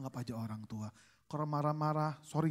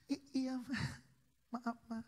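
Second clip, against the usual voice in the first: timid wife; 7 semitones higher, 6 dB quieter, slower than usual. Retold or imitated. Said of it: "istri saya kan orang yang pendiam".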